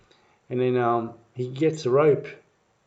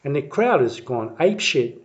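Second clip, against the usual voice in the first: normal talking voice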